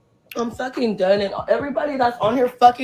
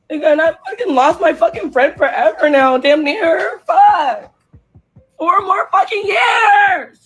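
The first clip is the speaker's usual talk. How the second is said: wailing cry, much higher and louder; crying outburst; 6 semitones higher, 5 dB louder than usual. Real, not acted. Fan raging over losing a friend for four years, crying shout. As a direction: break into a real sobbing shout, stretching the last words. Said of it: "I'm crying".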